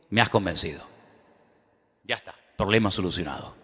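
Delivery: understated voice of God, settling it; God conceding. Acted. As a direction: quietly concede the argument. God's calm answer, acted in retelling.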